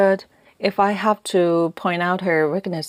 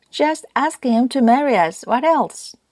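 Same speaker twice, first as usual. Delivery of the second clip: higher-pitched, exasperated comeback; mildly fierce, pitched-up retort; 7 semitones higher, 3 dB louder than usual. A narrator's reading. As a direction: throw it back as an obvious answer. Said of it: "with that fierce retort".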